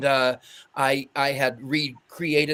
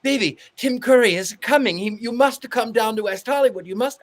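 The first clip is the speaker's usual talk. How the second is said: put-on high, insistent Italian-accented pleading; higher-pitched mock Italian accent; 6 semitones higher, 3 dB louder than usual. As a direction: imitate an Italian friend urging someone to come. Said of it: "my friend who's Italian".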